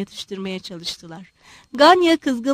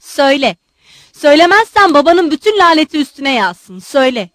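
loud, heated curse; roaring demand; 3 semitones higher, 8 dB louder than usual. A narrator's reading. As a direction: roar the order, threatening a father's curse. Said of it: "kükremeye devam ediyordu".

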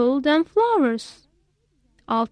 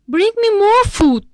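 a hungry king calling for more; louder demand; 6 semitones higher, 5 dB louder than usual. Acted. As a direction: raise the voice, impatient king.

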